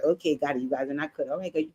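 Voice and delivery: quietly menacing. Retold or imitated. Hannibal's cold reply retold.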